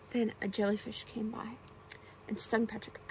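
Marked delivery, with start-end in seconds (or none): none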